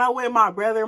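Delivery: ordinary delivery, nothing marked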